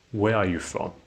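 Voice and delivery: WHERE on top, stepping down to FROM; falling question intonation. Shown as a demonstration. Teacher repeating a W H question example, falling intonation. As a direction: close the question downward on FROM. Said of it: "It is only falling".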